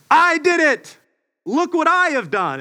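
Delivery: high, hammed-up boast; mock proud child. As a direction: crow like a proud little kid. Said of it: "we're standing there going".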